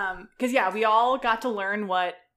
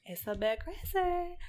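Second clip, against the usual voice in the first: mock ominous; 10 semitones higher, 7 dB quieter than usual. Real, not acted. Drawn-out playful remark.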